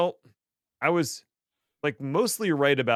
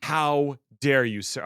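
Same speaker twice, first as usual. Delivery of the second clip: mock outrage; 4 semitones higher, 4 dB louder, slower than usual.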